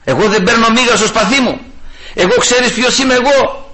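high-pitched, puffed-up bragging; egotistical boasting. Acted. Preacher mimicking the ego inside people, indignant boast. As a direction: act out a proud man throwing his weight about. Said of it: "υπάρχει εγωισμός μέσα στον άνθρωπο".

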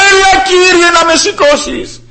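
high shouted plea to the Lord; cry for help. Acted. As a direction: call out to be lifted up. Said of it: "ζητάει βοήθεια". (δυνατή έκκληση για βοήθεια)

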